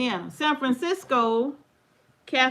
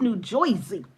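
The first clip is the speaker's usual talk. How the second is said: put-on Jersey accent; 3 semitones lower than usual. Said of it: "that's how they say it".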